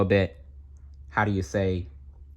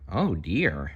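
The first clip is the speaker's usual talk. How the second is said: plain reading voice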